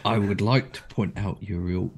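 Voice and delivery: usual speaking voice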